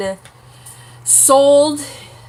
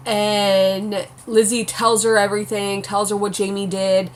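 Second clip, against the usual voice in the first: ordinary talk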